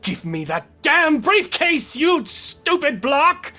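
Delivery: high, loud furious demand, slowing on the insult; angry yell. Acted. Game master as a villain demanding his briefcase, angry yell. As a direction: bellow the demand at the thief.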